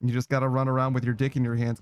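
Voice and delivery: ordinary talk, nothing marked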